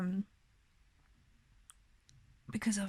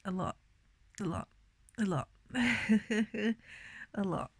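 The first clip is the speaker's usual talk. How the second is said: emotional. Real, not acted. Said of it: "I'm in pain as well".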